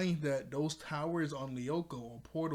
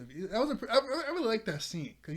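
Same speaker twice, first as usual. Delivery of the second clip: high-pitched, louder, through real laughter; laughing; 8 semitones higher, 4 dB louder than usual. Real, not acted. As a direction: giggle your way through the praise. Breaks into laughter while commenting.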